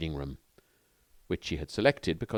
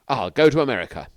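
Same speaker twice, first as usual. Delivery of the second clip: sudden resolve; 6 semitones higher, 9 dB louder than usual. Acted. Sudden decision, said aloud.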